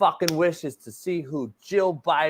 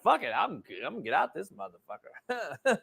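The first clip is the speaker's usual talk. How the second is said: laughing quote; 4 semitones lower, 10 dB quieter than usual. Retold or imitated. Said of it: "She's like".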